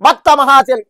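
loud, high, slow canvassing announcement; high, slow, shouted temple-festival announcement; street announcer impression. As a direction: boom it out like a town crier on a cycle loudspeaker. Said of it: "ఆయన voice ఎలా ఉంటుందంటే".

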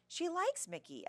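high-pitched indignant objection; insistent, high protest. A narrator's reading. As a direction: object strongly, voice jumping up. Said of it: "Ella protested".

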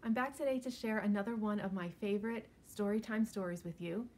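ordinary delivery

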